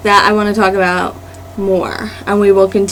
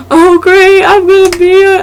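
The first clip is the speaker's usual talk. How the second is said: mock dismay; 8 semitones higher, 4 dB louder than usual. Camera pointed at her, mock dismay.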